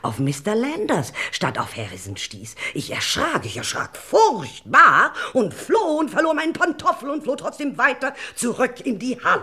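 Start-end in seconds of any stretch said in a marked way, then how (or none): 2.7-9.4 high, loud panicked cry; frightened outburst. Acted. Witness reliving her fright before the inspector, panicked. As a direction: blurt it out in rising terror.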